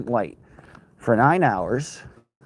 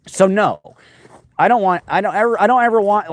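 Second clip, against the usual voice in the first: louder and higher, firmly saying no; emphatic refusal; 8 semitones higher, 6 dB louder than usual. Real, not acted. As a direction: push it out with real conviction. Emphatic refusal to return to news.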